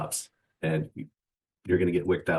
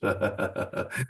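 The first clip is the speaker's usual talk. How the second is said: breathy mocking laugh; 2 semitones higher than usual. Real, not acted. Mocking the traders.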